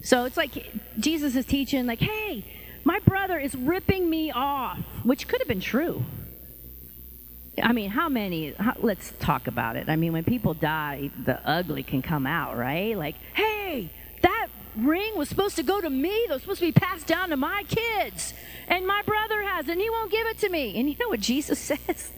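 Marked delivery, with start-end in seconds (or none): 2.0-4.8 high-pitched imitation of a griping brother; mock complaint. Brother complains to Jesus while he teaches, mock indignant. 13.3-20.8 high-pitched squabbling heir; mock indignation. Heir fights over a ring after a death, mock outraged.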